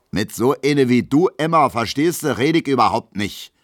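laut und höher, voller Verachtung; verächtlich. A narrator's reading. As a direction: von oben herab abfertigen. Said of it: "meint Gustav Schmelow verächtlich". (Schmelow weist Emma verächtlich ab)

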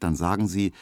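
ordinary delivery

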